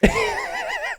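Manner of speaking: high burst of laughter. Real, not acted. Caster laughing at his own misclick, high laugh.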